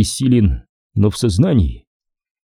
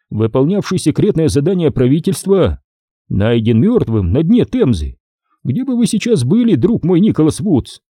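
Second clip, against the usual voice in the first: higher-pitched, scornful lecture; sarcastic major; 5 semitones higher than usual. Acted. Major scolding Nick over the consequences, sarcastic. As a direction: berate with raised pitch and mock approval.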